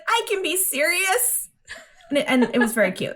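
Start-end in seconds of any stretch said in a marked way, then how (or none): 0.1-1.5 high, drawn-out mock insistence; imitating a girl's protest, pitched up and slow; retold protest. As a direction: insist in a higher, stretched-out voice. Retold indignant protest to doubters.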